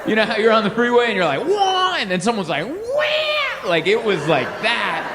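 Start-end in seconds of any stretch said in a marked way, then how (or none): 1.5-2.0 high mock scream of road panic; freeway scream. 2.7-3.7 very high startled mock shriek; answering scream. Other driver scream.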